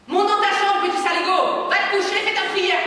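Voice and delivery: put-on enraged father shouting at the boy; furious shout. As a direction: yell him up to his room. Father ordering child to bed.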